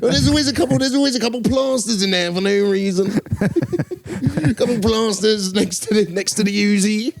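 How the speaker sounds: hammed-up comic presenter, pushing the joke; Michael McIntyre impression. Riffing on the host's gangland narration voice. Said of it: "That was a good McIntyre impression".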